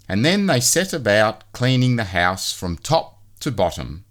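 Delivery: ordinary delivery, nothing marked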